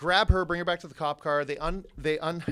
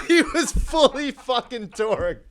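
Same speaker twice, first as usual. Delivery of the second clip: higher, louder, slowed words through laughter; laughing; 8 semitones higher, 7 dB louder, slower than usual. Real, not acted. Telling how mad his friend was, laughing.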